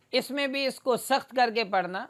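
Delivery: ordinary talk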